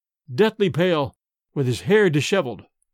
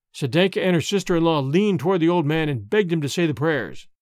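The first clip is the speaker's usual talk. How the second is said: ordinary delivery, nothing marked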